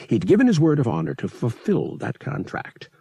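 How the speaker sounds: plain reading voice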